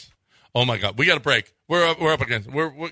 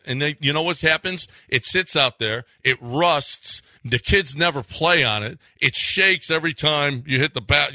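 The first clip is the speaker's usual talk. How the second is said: upbeat radio ad read, pitching hard to dads; energetic live sponsor spot. Acted. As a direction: sell the basketball goal like a live host read. Reads a live ad for Pro Dunk.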